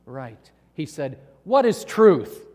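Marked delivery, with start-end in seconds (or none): 1.5-2.5 loud, slow challenge; emphatic question. Acted. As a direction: ask it forcefully, drawing it out. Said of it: "we don't know exactly his ... vocal inflection".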